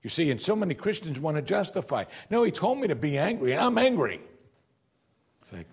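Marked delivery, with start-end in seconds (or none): 2.3-4.2 higher, insistent mock defensiveness; indignant self-justification. Preacher voices Christians justifying their anger, mock-indignant. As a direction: mimic indignant protest, pitched up.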